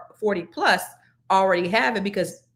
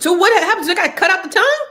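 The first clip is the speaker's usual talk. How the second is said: alarmed surprise; 9 semitones higher, 7 dB louder, faster than usual. Real, not acted. Shocked at cancer news.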